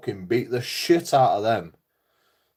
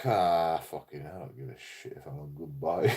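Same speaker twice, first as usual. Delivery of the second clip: lower, much softer dog voice; grumpy dog voice; 10 semitones lower, 9 dB quieter than usual. Retold or imitated. Owner voicing his stubborn old dog ignoring praise, low and soft. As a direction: mutter it like a dog that couldn't care less. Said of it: "some dogs are just like".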